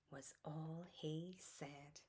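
soft slow bedtime whisper. A narrator's reading. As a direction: whisper so the child stays asleep. Story ends, child asleep.

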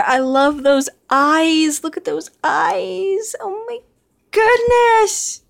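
slow gush climbing into a high squeal; real squealing adoration, rising high; adoring. Real, not acted. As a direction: melt with delight, drawing words out. Adoring the horse's eyes.

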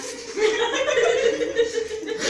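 high, loud laugh; burst of laughter. Real, not acted. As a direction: crack up mid-story. Storyteller breaks into laughter.

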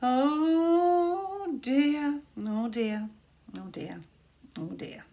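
high fretful voice fading to a murmur; fretful creature. A narrator's reading. A frightened follower dreading the witch, high then fading. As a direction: wring your hands over each oh dear.

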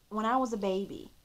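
normal talking voice

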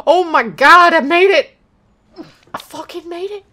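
loud joyful cry of relief; relieved excitement. Real, not acted. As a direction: celebrate finally making it. Succeeds after struggle.

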